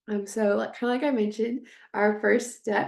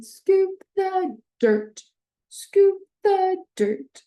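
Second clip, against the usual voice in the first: scoop stressed, higher, louder and slow; acoustic highlighting; 10 semitones higher, 7 dB louder, much slower than usual. Shown as a demonstration. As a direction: sing it out high for a child. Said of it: "so just sitting in".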